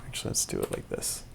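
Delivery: quick whispered aside to self. Real, not acted. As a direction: mutter it under the breath, quickly. Muttered aside while muting drums.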